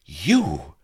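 loud, shocked outburst; a sharp cry at the sudden intruder; startled cry. A narrator's reading. Vanity shocked to see Blood, crying out. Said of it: "cried Vanity".

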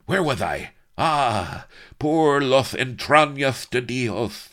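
high, loud, exasperated tyrant; bluster, then swear grandly in Spanish; blustering Spanish villain. A narrator's reading. Villain loses his place mid-threat, blustering. Villain rants on after interruption.

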